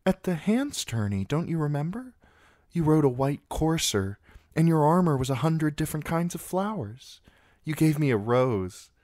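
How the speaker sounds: put-on high girlish voice, wistful and eager; a smitten girl remembering, voice lifted high; eager young girl. A narrator's reading. Sansa reminds Sir Loras of the tourney, high and eager.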